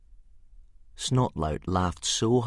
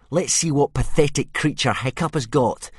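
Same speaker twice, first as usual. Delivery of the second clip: bully voice; 8 semitones higher, 8 dB louder than usual. A narrator's reading. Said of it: "said Snotlout".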